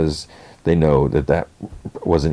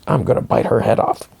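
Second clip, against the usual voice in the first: higher-pitched retold threat, played annoyed; mock angry threat; 6 semitones higher than usual. Cake threatens to bite her head off, mock angry. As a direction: imitate an exasperated character making a threat.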